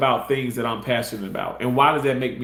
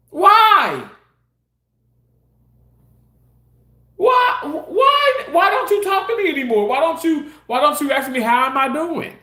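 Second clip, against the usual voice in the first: much higher, loud, whining demand; whiny coworker; 11 semitones higher, 5 dB louder than usual. Retold or imitated. Coworker complains he is no longer spoken to, high whiny shout. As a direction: squeal it like a hurt, nagging coworker.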